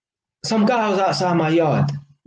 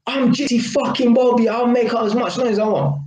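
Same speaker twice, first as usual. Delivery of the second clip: higher, defiant quoted brag; cocky retort; 8 semitones higher than usual. Retold or imitated. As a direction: throw it back like an arrogant neighbour. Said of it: "And a man has said".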